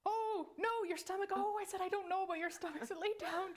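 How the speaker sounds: high, hurried backpedalling, retold; comically frantic protest in a high voice; flustered denial. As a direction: rush to head off a friend's panic. Retold flustered reply to alarmed friend.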